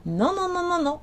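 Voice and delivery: a string of high, insistent nos; urgent high "non non non"; emphatic refusal. Real, not acted. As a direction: shut the idea down firmly. Firmly rejecting the chaser's excuse.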